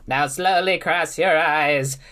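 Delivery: slow, put-on sinister butler; Peppermint Butler voice. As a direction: draw it out like a creepy servant. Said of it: "should I do Death or Peppermint Butler".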